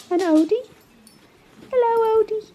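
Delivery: high, squeaky cooing pet voice. Real, not acted. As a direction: coo to the dogs like babies. Cooing at the dogs.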